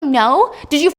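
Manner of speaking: loud, fast, rising into a furious yell; panicked screaming. Acted. Eve screams at the corrupt police chief on the phone, panicked rage. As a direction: scream at him, losing control. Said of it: "And she's screaming".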